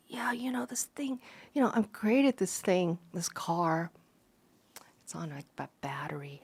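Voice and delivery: soft, hushed mock Elon, halting; lightly voiced soft-spoken inventor; imitating a mumbling guy. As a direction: mumble it shyly, half whispered. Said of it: "kinda stuttering a little bit, had a little bit of an accent".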